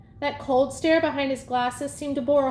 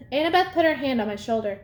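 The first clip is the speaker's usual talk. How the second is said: ordinary delivery, nothing marked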